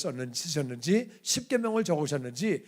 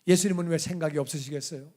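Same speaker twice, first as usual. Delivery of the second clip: normal talking voice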